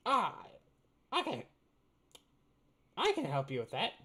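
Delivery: high goblin voice; squeaky little shopkeeper; pixie. Acted. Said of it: "given this guy three voices".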